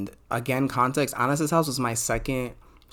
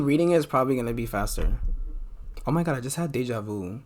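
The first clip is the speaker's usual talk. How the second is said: ordinary talk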